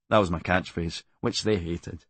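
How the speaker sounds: ordinary talk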